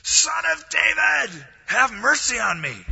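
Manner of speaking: loud, high, slow desperate shout; yelling for mercy. Acted. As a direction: cry out over the crowd to be heard. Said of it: "I'm gonna yell as loud as I can".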